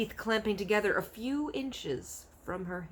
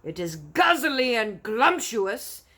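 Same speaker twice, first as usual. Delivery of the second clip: slow, much louder giant shout; 5 semitones higher, 10 dB louder, slower than usual. Acted. Bloodbottler shouting his love of human beans. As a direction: bellow it with relish. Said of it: "shouted the blood bottler".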